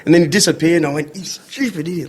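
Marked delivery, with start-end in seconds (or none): 1.1-2.1 much softer, higher aside to oneself; muttered self-reproach. Retold or imitated. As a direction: berate yourself under your breath. Retold muttered self-reproach after embarrassment.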